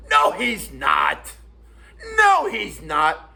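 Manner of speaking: drawn-out mock shout, each word leaned on; slow, emphatic retold contradiction; imitating insistent shouting. Retold argument, other man contradicts him.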